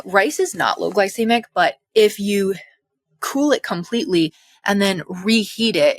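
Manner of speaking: normal talking voice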